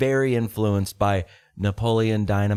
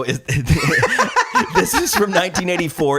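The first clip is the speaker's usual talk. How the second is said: laughing mock inspection; 5 semitones higher, 4 dB louder than usual. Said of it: "You look at it and you go".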